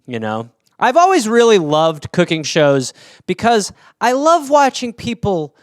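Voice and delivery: ordinary talk, nothing marked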